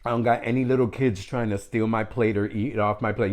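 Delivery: ordinary delivery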